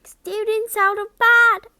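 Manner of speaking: high, loud gleeful bellow. Acted. Peeves alerting the castle, loud high bellow. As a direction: yell it down the halls to raise alarm. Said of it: "Peeves bellowed".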